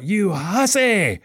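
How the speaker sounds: high, long-drawn-out yell; mock yell. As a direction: imitate a preacher yelling an accusation, stretching the words. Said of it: "he just yelled out".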